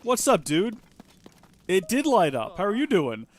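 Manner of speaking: lightly voiced game character. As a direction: read the NPC's lines with energy. Voices game NPC dialogue.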